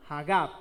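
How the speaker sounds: Hagab with the accent on its last A; low-pitched Hagab, stressed ha-GAB; stress on final A. Shown as a demonstration. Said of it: "con tilde en la A final".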